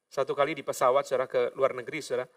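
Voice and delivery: usual speaking voice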